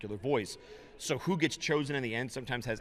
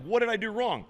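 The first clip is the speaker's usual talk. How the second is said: much higher, puzzled and dismayed; baffled dismay; 10 semitones higher, 3 dB louder than usual. Retold or imitated. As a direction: ask it high, genuinely lost. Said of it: "you're like".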